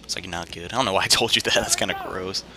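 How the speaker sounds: ordinary talk, nothing marked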